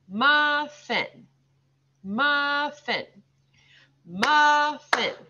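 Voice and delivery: muffin split into two long beats; slow, broken up. Shown as a demonstration. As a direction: stretch and separate muf and fin in "muffin". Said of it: "say it slowly and kind of break it up".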